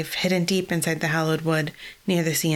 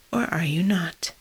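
whisper. Acted. Elder's solemn question.

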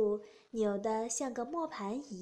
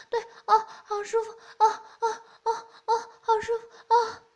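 a much higher, louder voice, breathless ecstatic cries; high loud moaning woman; 9 semitones higher, 10 dB louder than usual. Acted. Middle-aged woman to her lover, high loud ecstatic moaning. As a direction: cry out high and loud, lost in passion. Woman character in erotic scene.

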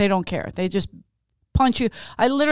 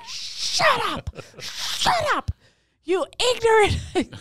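much higher, strained shut up; mock hissed shush; 13 semitones higher, much slower than usual. Woman shushing her brothers so the stranger won't hear, high and strained. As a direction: hush them frantically under her breath.

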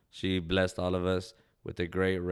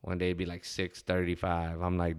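ordinary delivery

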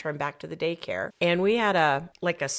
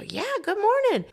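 much higher, cheery greeting; a light imitation of a confused old man's cheery hello. Confused old James greeting them at dusk, cheery. Quoting old man again.

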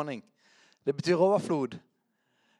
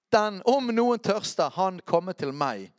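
slow, higher-pitched call that eases off; raised proclaiming quote; 6 semitones higher, 5 dB louder, slower than usual. Acted. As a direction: call out the verse, then settle. Proclaims the quoted cry once more.

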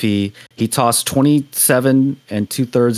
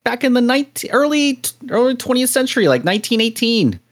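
put-on higher old-fashioned announcer voice; old-timey voice; 10 semitones higher than usual. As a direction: talk like a vintage newsreel man. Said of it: "I love your old-timey voice".

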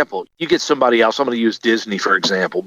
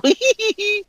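cartoon giggle. Shaggy parody ends.

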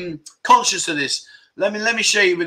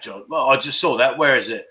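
ordinary talk

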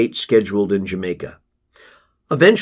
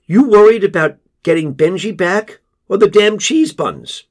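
crying out; 8 semitones higher, 5 dB louder than usual. Acted. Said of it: "Katie cries out".

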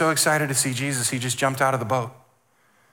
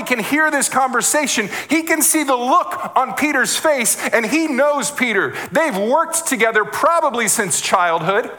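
high, louder building sermon climax; fervent preaching; 8 semitones higher, 3 dB louder than usual. Acted. Pastor to congregation, building preaching fervor. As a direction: preach it up with rising fire.

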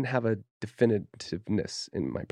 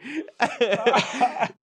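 high, real burst of laughter; 13 semitones higher, 4 dB louder than usual. Real, not acted. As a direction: crack up at your own act. Laughs at own gangster act.

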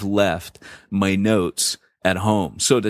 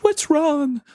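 much higher, caring motherly voice; concerned question. Retold or imitated. Elder's wife asking the downcast pastor, high concerned voice. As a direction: ask like a worried friend.

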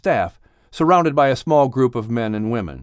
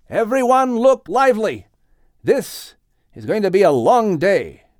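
higher, brisk rallying call; exclaiming; 8 semitones higher than usual. A narrator's reading. Noah exclaiming to his family, higher and rousing. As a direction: rouse everyone to work. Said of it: "exclaimed Noah".

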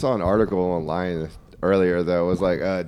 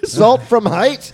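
high, loud, drawn-out mock scream; Gordon Ramsay; 12 semitones higher, 6 dB louder, much slower than usual. Host imitating TV chef screaming in his sleep, mock yelling. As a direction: yell it like a kitchen tyrant. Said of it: "Gordon Ramsay has screamed at my subconscious".

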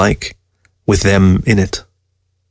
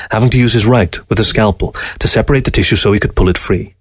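usual speaking voice

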